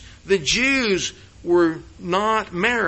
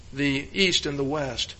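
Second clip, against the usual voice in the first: usual speaking voice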